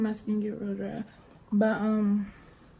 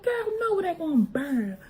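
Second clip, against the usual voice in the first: mom reassuring; 8 semitones higher than usual. Retold or imitated. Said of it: "She said".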